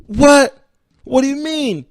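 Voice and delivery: high, loud, stunned double what; shocked disbelief. Retold or imitated. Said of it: "He's like".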